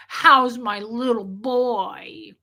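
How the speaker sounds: put-on slow, syrupy baby talk to a grown son; mother-in-law cooing on speakerphone, slow and syrupy; creepy doting mother. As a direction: drip with sugary fake sweetness, drawn out. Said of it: "It was so creepy".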